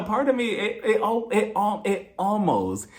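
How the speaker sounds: ordinary delivery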